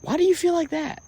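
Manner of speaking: high, soft mimicking voice; mocking high-pitched question; mock questioner. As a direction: put on a high, quiet prying voice. Mock questioner answering his feeling.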